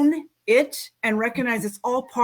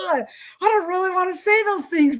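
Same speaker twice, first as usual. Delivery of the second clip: high, whiny ugh of not wanting to speak; reluctant groan; 9 semitones higher than usual. Retold or imitated. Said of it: "I'm like".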